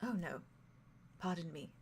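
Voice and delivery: gentle soft apology; softly, kindly excuse yourself; hushed polite pardon. Acted. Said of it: "who just very softly says".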